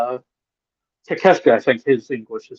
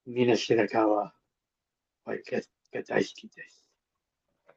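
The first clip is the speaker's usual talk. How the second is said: much softer put-on Japanese accent; mock Japanese accent; 2 semitones lower, 11 dB quieter than usual. Co-host gushes over wrestler in mock Japanese. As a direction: coo her name like a fan in Japanese. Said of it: "you used that weird accent".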